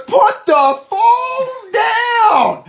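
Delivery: very high, stretched-out yell; slow hammed-up cop shout; shouted cop order. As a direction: yell the command, dragging every word. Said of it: "and he goes".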